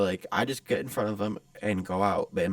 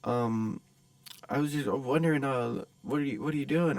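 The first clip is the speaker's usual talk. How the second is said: higher, hesitant impersonation; timid stranger; 5 semitones higher than usual. Retold or imitated. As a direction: fumble through an awkward approach. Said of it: "and, like, timid".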